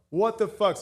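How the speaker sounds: mock astonishment at the second lucky roll; stunned outburst. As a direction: blurt it out in disbelief. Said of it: "and I go".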